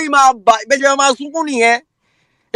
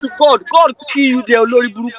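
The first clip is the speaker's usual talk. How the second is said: mock wailing politician; faster than usual. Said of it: "come say".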